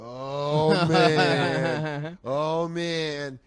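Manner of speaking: retold stoned awe, vowels stretched; tripping amazement. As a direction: marvel slowly, as if tripping on the lights. Retold tripping reaction to police lights.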